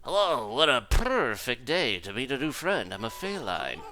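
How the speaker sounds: fancy cat character voice. Acted. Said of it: "gotta do some live voices here".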